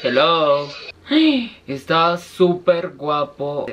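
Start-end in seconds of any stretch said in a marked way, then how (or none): 0.7-1.6 high sharp gasp; surprised gasp. Real, not acted. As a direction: gasp with delight. Sees a handsome man.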